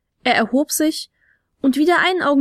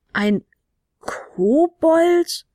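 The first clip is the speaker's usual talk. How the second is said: baffled; 5 semitones higher, much slower than usual. A narrator's reading. Baffled on meeting a kobold.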